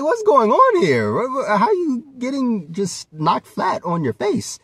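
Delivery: mock shock at the beating; incredulous outburst. Asking Frieza why he keeps losing, high incredulous disbelief. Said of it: "It's like".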